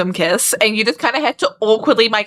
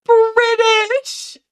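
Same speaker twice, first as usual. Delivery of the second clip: very high delighted squeal; 11 semitones higher than usual. Real, not acted. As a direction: squeal the word out. Squealed repetition.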